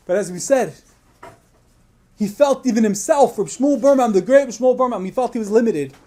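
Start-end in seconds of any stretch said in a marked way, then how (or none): none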